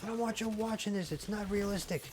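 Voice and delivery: high, outraged mock-dad rant; retold screaming. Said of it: "I'm screaming at the T V".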